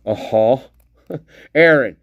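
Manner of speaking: ordinary talk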